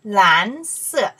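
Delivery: 蓝 loud and rising, then 色 falling; teacher's emphatic 蓝色, first syllable sweeping up; second tone. Shown as a demonstration. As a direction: model 蓝色 with a strong upward glide on 蓝. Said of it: "Second tone".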